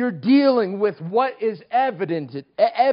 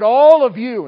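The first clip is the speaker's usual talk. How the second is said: loud, raised voice pointing at the crowd; accusing call; 6 semitones higher, 12 dB louder than usual. Retold or imitated. Girl at the microphone accusing the teenagers, loud and raised. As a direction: call them all out loudly.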